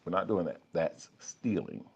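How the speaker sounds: a calm inner voice talking you out of stealing; quiet conscience. Pretend. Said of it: "Versus the other voice that said".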